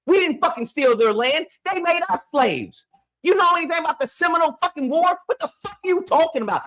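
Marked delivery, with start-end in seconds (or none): 3.2-6.6 heated, fast, high-pitched outburst; indignant, rapid-fire demand; angry challenge. Real, not acted. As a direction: fire questions at them in anger. Heated argument with the caller.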